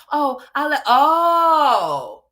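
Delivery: hammed-up sing-song excuse; parodying the streamer's excuse in a mock voice; mocking imitation.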